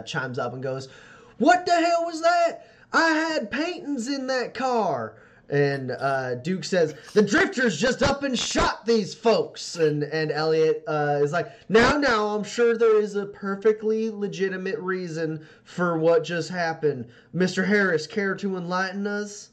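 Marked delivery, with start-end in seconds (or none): 1.4-5.2 high, slow, indignant shriek of a woman who lost her paintings; shrill outraged lady voice; outraged socialite. Acted. GM voices Lola Douglas upset. 7.1-9.7 high, slow, indignant man's voice; raised, put-on indignant voice; angry shopkeeper. 11.7-19.5 higher, refined, soothing voice; calm everyone down with polished charm; put-on genteel mediator; smooth placating gentleman. GM voices Elliot smoothing things.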